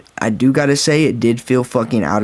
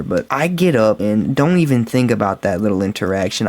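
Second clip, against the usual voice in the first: normal talking voice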